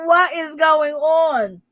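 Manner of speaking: high, drawn-out disbelief; acted bewilderment. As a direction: stretch every word slowly in hammed-up amazement. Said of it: "I'm like".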